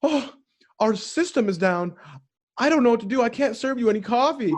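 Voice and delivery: high, flustered barista voice; panicked barista. Retold or imitated. Barista telling the old lady the system is down, high and flustered. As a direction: play the helpless barista who cannot cope. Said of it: "the barista's like".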